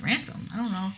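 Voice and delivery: very soft, muttered feigned confusion; quiet mock innocence. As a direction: murmur like playing dumb. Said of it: "He's like".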